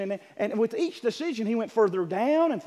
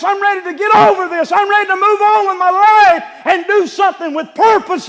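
desperate shout; 10 semitones higher, 13 dB louder than usual. Acted. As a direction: shout out your relief at being rescued. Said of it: "he was worshiping Jesus".

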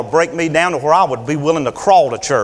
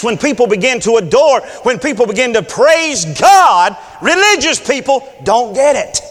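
preaching at full force; 5 semitones higher than usual. Acted. Preacher building toward a shout to the congregation. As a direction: drive it home like a revival preacher.

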